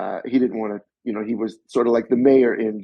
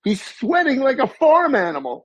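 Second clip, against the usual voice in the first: much higher, fussy voice of the crew member; imitating the assistant, much higher; mock disgusted assistant; 13 semitones higher than usual. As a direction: stop the take in disgust. Assistant halting the take.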